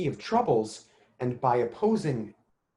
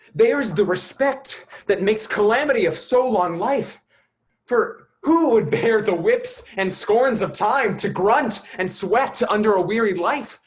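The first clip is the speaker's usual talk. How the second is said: higher, louder, impassioned outcry; rising passion; 7 semitones higher, 5 dB louder than usual. Acted. Hamlet rails against life's whips and scorns, impassioned and loud. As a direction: let the anguish swell and push it out.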